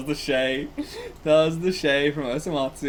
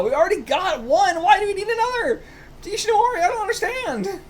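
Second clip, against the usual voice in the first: very high, loudening bewildered whine; exasperated dismay; 13 semitones higher than usual. Real, not acted. Reader protesting another baby on the team, bewildered. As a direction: plead in high-pitched disbelief.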